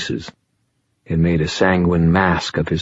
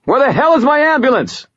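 loud, fast shout across the room; 4 dB louder than usual. A narrator's reading. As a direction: yell it out, high and hurried. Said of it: "She raised her voice and shouted".

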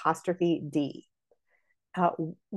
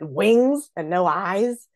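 "Wings" punched louder and very slow; stress on wings; 3 semitones higher, 4 dB louder, much slower than usual. Shown as a demonstration. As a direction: draw out "Wings" to get the joke. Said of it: "placing the emphasis on the words".

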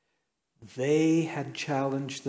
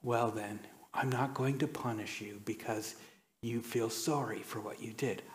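a light hint of a soft-spoken mother, much softer; gentle mother; 2 semitones lower, 8 dB quieter than usual. Mother gently letting her son off his spanking. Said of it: "And she said".

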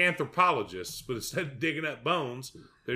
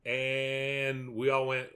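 usual speaking voice

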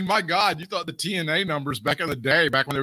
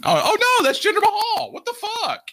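much higher, loud burst of surprise that breaks into a laugh; the penny drops, yelp it out; sudden realization; 3 dB louder than usual. Real, not acted. Realizes it is Jinder Mahal.

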